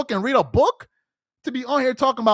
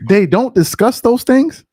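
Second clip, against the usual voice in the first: incredulous echo; 8 dB louder than usual. Real, not acted.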